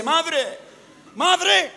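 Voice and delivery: a hint of a high calling child; child calling mother. Comedian voices a boy calling his mother at night. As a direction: call out for mum at night. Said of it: "se despierta y dice".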